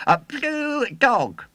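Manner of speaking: high, loud proud announcement; proud showman. A narrator's reading. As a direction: present the exhibit proudly. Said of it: "he repeated".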